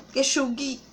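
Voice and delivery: ordinary talk, nothing marked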